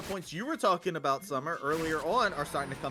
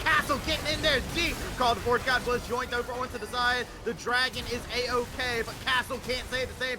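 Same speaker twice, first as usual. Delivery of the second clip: raised, high-pitched shoutcaster voice; excited play-by-play; 10 semitones higher, 9 dB louder than usual. Real, not acted. Caster calling a live team fight, excited play-by-play.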